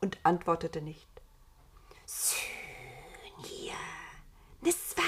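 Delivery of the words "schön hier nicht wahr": slithery whispered creature voice; sibilant, voiceless coaxing; hissing. A narrator's reading. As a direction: hiss the words like a snake, stretching them out. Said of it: "zischte das Namenlose".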